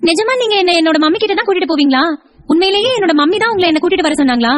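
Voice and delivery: put-on high little-kid voice; questioning child. Child asks aunty whether mother really sent her, high childlike questioning.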